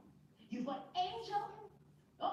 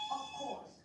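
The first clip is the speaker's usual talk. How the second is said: emphatic. Real, not acted. Emphatic agreement.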